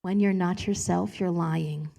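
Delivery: slow, unlike her own voice, God speaking; slow and measured, as if handed down. Retold or imitated. God correcting her overconfidence, slow and solemn. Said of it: "Until God's like".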